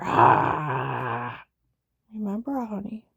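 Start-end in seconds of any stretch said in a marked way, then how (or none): 0.0-1.4 loud, lower drawn-out cry; loud wail. Retold or imitated. Woman giving in under threat, loud wail. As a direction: let out a long, loud "ah".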